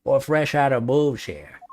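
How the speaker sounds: gruff detective character voice; a hint of a gruff detective; Gumshoe voice. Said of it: "That transition of voices is hard".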